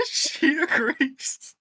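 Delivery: high and slow through a laugh; laughing falsetto; feminine voice, laughing. Acted. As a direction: stretch it out high while laughing. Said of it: "Wow, that's really good".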